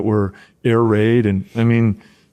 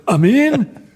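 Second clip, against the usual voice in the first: can't believe he's being put in; stunned disbelief; 13 semitones higher than usual. Retold or imitated. Freshman reacting to the coach sending him in, shocked high question.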